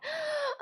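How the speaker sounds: squeaky gasp of indecision; mock dramatic gasp; high dramatic gasp. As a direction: gasp like it's the hardest choice. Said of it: "And I'm like".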